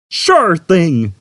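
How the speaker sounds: cheery computer. A narrator's reading. Computer answering Zaphod, cheery. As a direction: chirp like an eager salesman.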